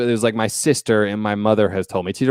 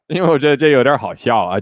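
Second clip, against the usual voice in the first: ordinary talk, nothing marked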